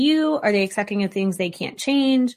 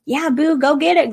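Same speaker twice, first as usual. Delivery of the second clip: encouraging partner; 5 semitones higher than usual. Retold or imitated. Partner cheering you on before a big try. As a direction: pump them up like a cheerleader. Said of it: "Do they say, like".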